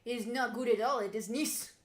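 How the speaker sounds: loud, hammed-up Russian accent, slowed down; mock Russian accent. Streamer loudly mimicking the video's pilot in a mock Russian accent. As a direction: bellow it like the clip's Russian pilot.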